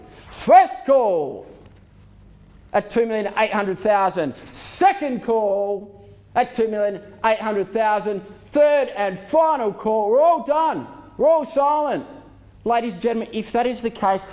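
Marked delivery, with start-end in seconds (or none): 0.1-1.5 calling out. Real, not acted. Auctioneer calling first call. 4.4-12.1 slow. Real, not acted. Auctioneer drawing out final call.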